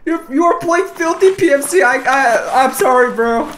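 high, loud mocking apology; gleeful taunt. Real, not acted. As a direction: rub it in, laughing inside. Taunting enemy player after kill.

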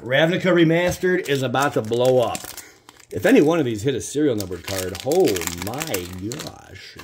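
loud, high, excited announcement; building hype. Real, not acted. Telling viewers a serial card would end it, loud and hyped. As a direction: pump up the viewers. Hyping possible serial pull.

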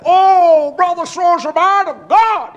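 high, loud mocking believer voice; shouted mock praise; mock gullible believer. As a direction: ham up a naive churchgoer gushing.

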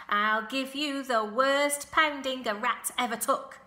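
loud, high, blustering gander; stern threat. A narrator's reading. Gander protecting his goslings from Templeton, stern threat. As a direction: warn the rat off, loud and forceful.

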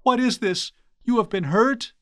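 high-pitched shocked questioning; alarmed concern. Acted. Priest finding his blind friend hurt, high-pitched alarm. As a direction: cry out in high, startled concern.

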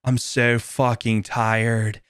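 slow, lightly voiced surfer dude; surfer guy voice. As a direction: drawl it like a tired surfer. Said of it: "he sounds like a surfer guy".

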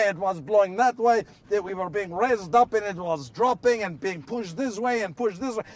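ordinary talk

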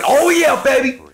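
high, excited whoop; hyped-up cheer. Real, not acted. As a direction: whoop it up for the crowd. Hyping up chat for the stream.

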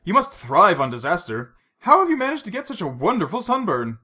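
swooping high on the compliments; wry, admiring welcome. A narrator's reading. Bond greets a tanned Vesper, wry amazement. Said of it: "with a wry gesture of welcome".

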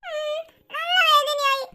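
high, slow, crying little voice; tearful whimper. Acted. Little boy telling sister that it hurts, tearful whimper. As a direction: whimper it out, drawn and hurt.

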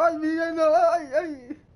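high, loud panicked wailing; desperate panic. Retold or imitated. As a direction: squeal it like a guy caught in a panic. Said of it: "Y él desesperado".

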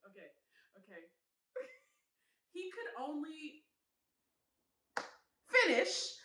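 usual speaking voice

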